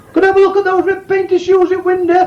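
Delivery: high-pitched, polite customer asking. Acted. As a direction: play the shy customer up high.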